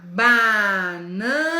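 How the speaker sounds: Banana said slowly as ba-na-na, syllable by syllable; slow syllable split for children. Shown as a demonstration. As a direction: break Banana into its three syllables. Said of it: "Como é que eu separo banana?".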